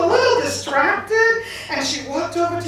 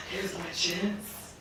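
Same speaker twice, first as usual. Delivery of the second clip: low, very soft, slow scheming; sly aside; 10 semitones lower, 15 dB quieter, slower than usual. Acted. God spotting the goddess in the woods, hushed aside. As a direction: keep it secret, slow and deep. God plotting as he spots her.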